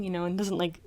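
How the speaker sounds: ordinary talk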